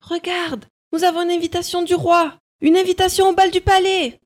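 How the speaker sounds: high, fast, thrilled; excited stepsister. A narrator's reading. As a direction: gush about the invitation. Sisters excited about invitation.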